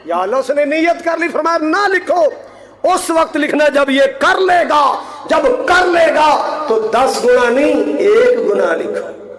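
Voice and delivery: impassioned, ringing proclamation; fervent preaching. Real, not acted. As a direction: drive it out to the congregation like a preacher. Preaches at full force.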